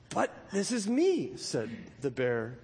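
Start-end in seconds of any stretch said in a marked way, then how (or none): 0.1-1.3 slow, higher, surprised protest. A narrator's reading. Pooh tells Rabbit it's him, surprised. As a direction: Pooh is baffled at being asked for. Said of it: "very much surprised".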